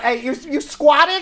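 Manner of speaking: brash Long Island "Hey, you"; Long Island accent. Shown as a demonstration. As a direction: tough Long Island guy. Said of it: "it's my Long Island".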